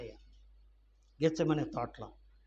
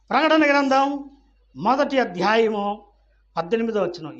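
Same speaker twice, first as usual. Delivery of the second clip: raised, loud scripture reference; emphatic announcement; 5 semitones higher, 7 dB louder than usual. Real, not acted. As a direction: call the reference out to everyone. Same reference re-announced loudly.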